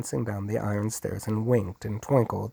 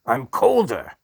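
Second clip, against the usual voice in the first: louder, higher snarled retort; 4 semitones higher, 7 dB louder than usual. A narrator's reading. The Duke snarls back at Hark, higher and louder. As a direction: snap it back, outdoing him. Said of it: "snarled the Duke".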